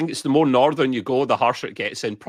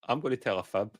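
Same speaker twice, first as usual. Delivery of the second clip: ordinary talk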